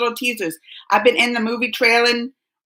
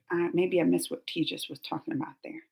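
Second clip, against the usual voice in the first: ordinary talk, nothing marked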